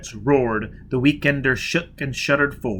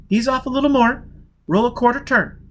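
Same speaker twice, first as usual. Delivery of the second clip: higher woman's voice, eager and quick; a young woman pitching a daring plan; Jiz, urgent plan; 11 semitones higher, 5 dB louder than usual. Acted. Jiz urging Foyle to blast the safe, eager woman's voice.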